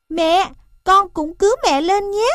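high little calf voice, eager; baby elephant. A narrator's reading. Calf at the pit's edge offers to save mother, high and eager.

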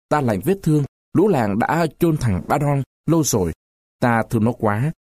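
ordinary delivery, nothing marked

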